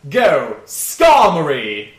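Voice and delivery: loud, slow trainer's battle call; with a flourish. Acted. Stranger sending out a Pokemon, grand loud call. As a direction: send it out grandly, drawing the words out. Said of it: "with a flourish".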